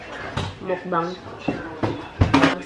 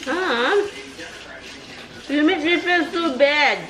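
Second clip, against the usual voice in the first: whiny complaint; 6 semitones higher, slower than usual. Acted. Playful complaint to husband.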